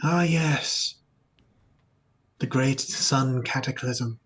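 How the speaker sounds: hushed, formal reverence; reverent cultist. Acted. Veiled church member recalling the great cataclysm, reverent. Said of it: "And they go".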